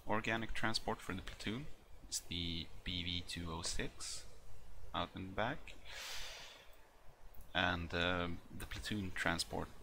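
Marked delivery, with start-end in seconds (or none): none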